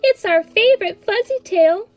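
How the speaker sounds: put-on high, loud girlish delight; gushing. A narrator's reading. Kitten sisters pleading for their favorite story, gushing. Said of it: "gushed the girls".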